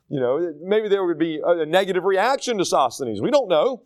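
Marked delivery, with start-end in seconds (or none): none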